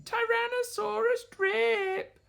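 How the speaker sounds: high, drawn-out name-calling; taunting sisters. A narrator's reading. Sisters naming their odd brother, high taunt.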